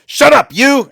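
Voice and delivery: furious guard bellowing at a prisoner; guard snarling at the mouthy prisoner, shouted; angry shout. Acted. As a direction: bark it like a brutal guard. Guard Riggs smacks prisoner.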